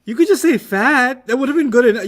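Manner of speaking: laughing objection to the insult; amused protest. Real, not acted. Teacher teases the student over saying fatty.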